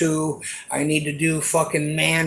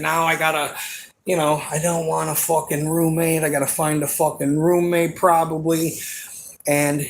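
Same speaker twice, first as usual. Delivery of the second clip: ordinary talk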